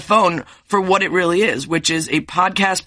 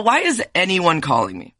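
ordinary delivery

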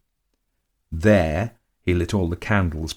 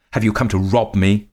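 put-on loud, higher cry of an old woman in fear; terrified scream; 4 semitones higher, 6 dB louder than usual. A narrator's reading. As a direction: scream it at the intruder. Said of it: "she screamed".

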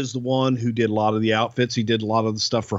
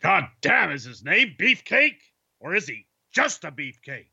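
higher, gruff boss voice; Vince McMahon; 4 semitones higher than usual. Pretend. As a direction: bark it like a wrestling promoter. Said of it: "let's freestyle and have some fun here".

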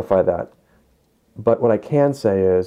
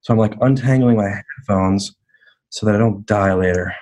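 speaking over the phone; tinny phone call audio; phone line; 8 semitones lower, 7 dB louder than usual. Real, not acted. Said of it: "I dialed him up".

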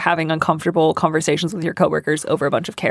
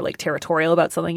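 ordinary talk, nothing marked

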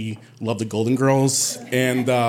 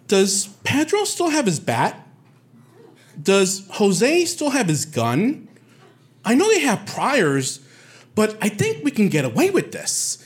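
higher, slow, lightly voiced plotting girl; mocking scheming girl; 8 semitones higher than usual. Girl on the train plotting against him, high and slow. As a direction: play up the girl's sly plotting.

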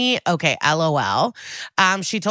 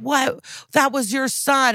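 higher-pitched retold confrontation; mock outrage; 7 semitones higher than usual. Retelling detective confronting the father, mock outrage. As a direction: throw it at them, raised in pitch.

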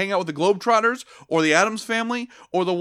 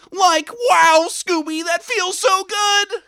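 high, loud and slow Shaggy; 9 semitones higher, 4 dB louder, slower than usual. Pretend. Host performing the requested line as Shaggy, high and loud.

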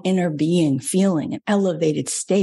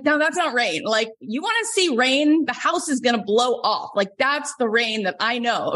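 scoffing retort; 7 semitones higher than usual. Retold or imitated. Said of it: "I'm like".

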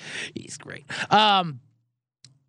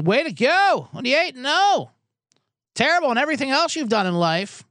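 mock texter; 7 semitones higher than usual. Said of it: "texts from people".

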